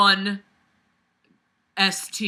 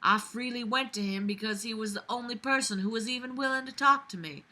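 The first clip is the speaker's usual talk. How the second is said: usual speaking voice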